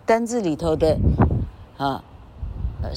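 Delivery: ordinary talk, nothing marked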